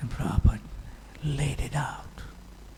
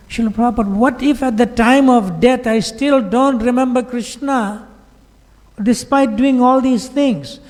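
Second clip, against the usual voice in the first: worried disciple asking his guru, higher pleading voice; anxious pleading disciple; 9 semitones higher, 4 dB louder than usual. Retold or imitated.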